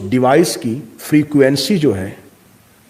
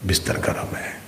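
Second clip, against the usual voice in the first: whispering lazy inner voice; whispered temptation; 11 dB quieter than usual. Acted. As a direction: whisper it, unvoiced and soft. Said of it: "اگلی آواز پھر آئی".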